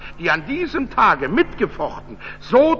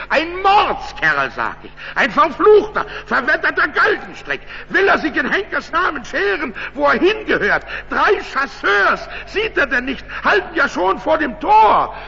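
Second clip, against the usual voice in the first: exasperated innkeeper; 7 semitones higher, 3 dB louder than usual. A narrator's reading. Innkeeper exclaims, French at the gate.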